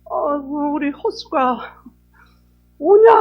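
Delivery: put-on high, slow elderly mother's call rising to a loud cry; imitating mother. Sister mimics late mother welcoming children home, high loud call. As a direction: mimic an old mother greeting her kids. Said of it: "어머니 흉내를 딱 내는 거예요".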